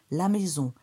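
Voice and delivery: ordinary delivery, nothing marked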